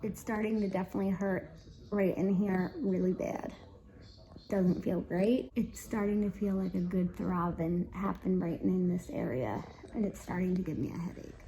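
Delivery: low, subdued voice with aching jaw; quiet and in pain. Real, not acted. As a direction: talk softly through the throbbing pain. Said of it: "and it hurts".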